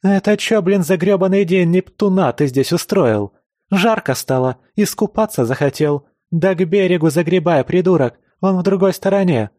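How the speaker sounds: shrieking high and loud; hysterical yell. Acted. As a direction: scream at him in a panic. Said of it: "истерический вопль наставницы". (истерический вопль)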